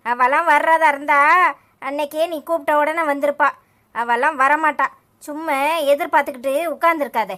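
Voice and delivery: loud, furious telling-off; angry scolding. Acted. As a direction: blast him for stalling the wedding. Mother scolding son at wedding. (மணமேடையில் உட்கார்ந்திருக்கும் மகனை கோபமாக கத்தித் திட்டுதல்)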